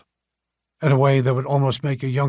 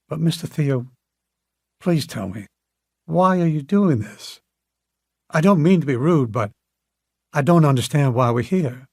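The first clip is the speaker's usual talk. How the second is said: put-on earnest young woman; 3 semitones higher than usual. Minette presses for reasons.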